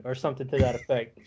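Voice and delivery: ordinary talk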